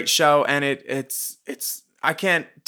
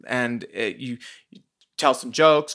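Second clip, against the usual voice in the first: usual speaking voice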